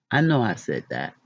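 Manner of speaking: ordinary talk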